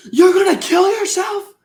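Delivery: high-pitched outraged shout; loud, hammed-up angry outburst; [name]. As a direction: crash out, yelling in disbelief. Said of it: "Reasonable crash out by [name]".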